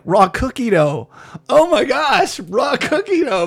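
the penny drops, say it amazed; surprised realization. Retold or imitated. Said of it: "how she was saying it".

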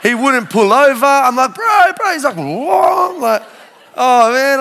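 loud, high retold chase calls; hammed-up road shouting; excited shouting. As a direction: yell after the car. Chasing driver on the road.

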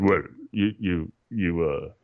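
badly imitated Dutch accent, halting. Friend starting to explain a dare, rough Dutch accent. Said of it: "I'm slaughtering his accent".

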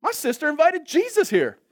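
high, loud, gushing newcomer; hammed-up carefree delight; delighted sister. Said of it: "The sister comes in".

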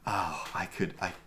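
normal talking voice

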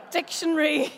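loud, high, laughing retelling; mock bewilderment breaking into laughter; amused bafflement. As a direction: exclaim it high, laughing between phrases. Comic retelling of baffling local words.

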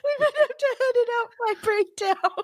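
can't stop laughing. Real, not acted. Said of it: "have mental problems".

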